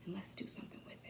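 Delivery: hushed, intimate whisper. Real, not acted. Urging listeners to act on anger, whispered. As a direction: drop to a secret whisper.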